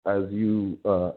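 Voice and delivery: muffled and faint, mic covered. Real, not acted. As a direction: voice smothered and far off. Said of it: "you sound muffled".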